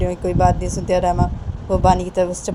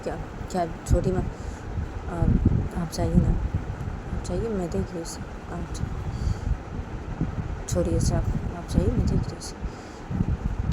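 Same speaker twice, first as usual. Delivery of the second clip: very soft, halting, partly whispered; hushed Bani; 5 dB quieter, slower than usual. Acted. Bani asking Chhoti Maa to leave it to her, hushed and halting. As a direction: quietly urge her to leave.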